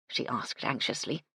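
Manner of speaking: plain reading voice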